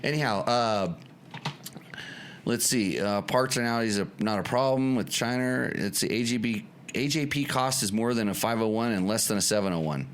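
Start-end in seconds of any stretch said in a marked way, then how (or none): none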